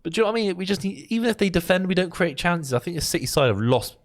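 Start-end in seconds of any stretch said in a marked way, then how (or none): none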